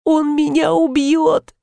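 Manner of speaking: high, slow, lightly hushed dread; frightened stage whisper. A narrator's reading. Youth hearing the husband come home, frightened stage whisper. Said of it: "прошептал юноша".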